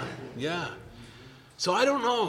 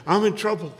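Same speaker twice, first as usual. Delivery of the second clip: higher and louder, frantic friend; mock panic; 7 semitones higher, 7 dB louder than usual. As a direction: plead like a man in a crisis. Panicked friend voiced high.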